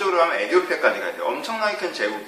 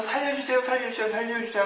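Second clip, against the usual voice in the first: higher, begging for his life from the pit; desperate pleading; 7 semitones higher, 5 dB quieter than usual. Retold or imitated. Joseph in the pit begging his brothers, high desperate pleading. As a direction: cry out to be spared, voice pitched up. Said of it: "하는 요셉의 심정을 생각해보세요".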